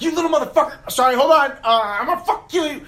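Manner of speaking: high, loud mock-furious shouting; hammed-up furious father yelling; angry dad yell. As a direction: retell dad blowing up at his kid. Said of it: "I turned around, I was like".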